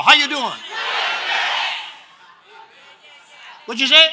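high, loud call to the congregation; loud preacher call-out; loud call and response. Real, not acted. Pastor calling out for response.

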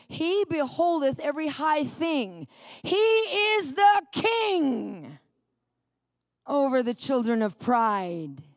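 booming, slow proclamation of king; solemn scripture reading. Acted. Preacher reads Job to the congregation, booming proclamation. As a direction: proclaim it slowly, rising to a shout. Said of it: "It says".

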